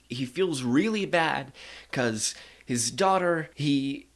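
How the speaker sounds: ordinary talk, nothing marked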